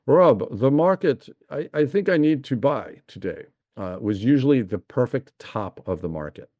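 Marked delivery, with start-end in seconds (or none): 0.1-3.4 imitating his late father's German accent, pitched higher; a light, self-admittedly rough German accent, as dad on the phone. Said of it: "he had a little bit of a German accent".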